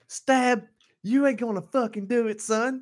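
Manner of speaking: high, loud mock holler; hollered dare. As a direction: call it out like a rowdy challenge. [name] hollers a dare in hallway.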